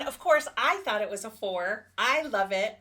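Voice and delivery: usual speaking voice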